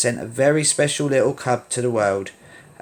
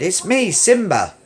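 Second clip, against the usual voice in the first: excited; 7 semitones higher, 4 dB louder than usual. Acted. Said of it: "he cried".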